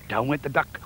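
usual speaking voice

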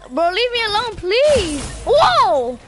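high, loud frantic begging; cornered and pleading for mercy; loud scared whoa; panicked pleading. Real, not acted. Enemy attacking him.

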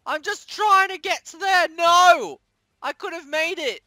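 high loud protesting shouts; panicked yelling. Real, not acted. Gamer yelling at attackers while trying to escape, panicked. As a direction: yell to make them stop.

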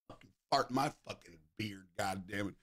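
ordinary talk

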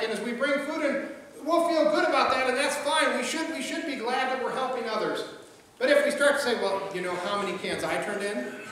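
usual speaking voice

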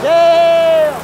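loud, high cheer of triumph; excited yell. Real, not acted. Cleaner cheering his own machine, loud excited yell. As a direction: whoop like the winner. Real cleaner starts working.

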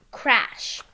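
ordinary delivery

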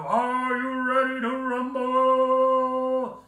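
long drawn-out booming ring call; wrestling announcer. Retold or imitated. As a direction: stretch every word like a ring announcer. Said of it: "he almost mimics the, announcer from, W W E".

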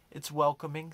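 usual speaking voice

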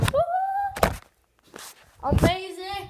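high excited whoop; excited cheer. Real, not acted. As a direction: cheer with a big high woo.

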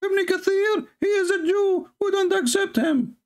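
very high squeaky mimicry of an opponent; high rabbit-like mock of an opponent; mocking opponent's voice. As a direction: parody the other side in a tiny cartoon voice. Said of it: "change your voice from the voice of a turtle or rabbit".

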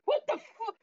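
acted high-pitched shock; startled outburst. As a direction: jump out of your skin in character. Startled kid jumps at being approached.

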